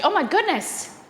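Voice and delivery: high amazed gasp of delight. Real, not acted. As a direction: marvel at it, way up high.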